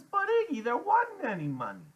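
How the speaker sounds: high, loud whine of objection; Loretta protesting. A narrator's reading.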